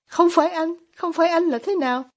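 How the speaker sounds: high-pitched startled question; taken aback, pitch jumping up; stunned. A narrator's reading. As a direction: narrate a mildly stunned echo. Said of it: "Ivan sững sờ".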